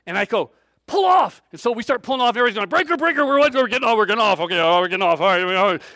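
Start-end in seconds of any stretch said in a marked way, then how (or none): 0.9-1.4 sharp high shout to pull over; shouted order. Retold or imitated. Leader yells to the cars to pull off the highway, loud. 2.7-5.8 CB radio chatter. Retold or imitated. CB radio chatter between cars.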